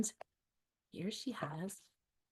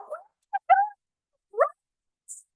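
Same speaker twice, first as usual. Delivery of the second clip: very high, loud broken sob; high crying wail; 7 dB louder than usual. Acted. Duckling crying that they cannot be friends. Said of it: "She says".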